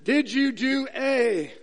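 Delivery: lightly voiced interrogator, slowly spaced words; questioner of politician. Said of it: "politicians are good at this".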